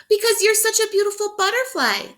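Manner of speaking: high, loud admiring monkey voice; gushing monkeys. Acted. Monkeys answering the butterfly, high gushing. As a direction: gush over the pretty butterfly. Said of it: "they answered".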